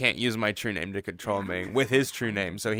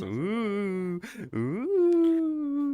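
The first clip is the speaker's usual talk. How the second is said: mock fearful whimper; 11 semitones higher, 3 dB quieter than usual. Mimicking a scared cry.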